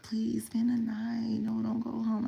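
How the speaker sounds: soft, slow, breathy begging; hushed coaxing plea; pleading whisper. Retold or imitated. Boyfriend begging her to stay.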